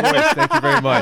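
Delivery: high, whiny and laughing. Pretend. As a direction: whine it out playfully. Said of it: "Can you read it in your whiny voice?".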